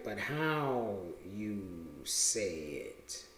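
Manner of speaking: very slow, low and hushed; drawn-out dictation. Real, not acted. Speaker sounding out a phrase while noting it, drawn-out. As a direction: stretch each word out quietly.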